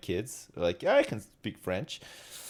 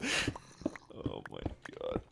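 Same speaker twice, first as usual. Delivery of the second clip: slow whispered despair, much lower and softer; exasperated; 14 dB quieter, much slower than usual. Real, not acted. Man exasperated after Alexa mishears, whispered. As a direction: groan it under your breath.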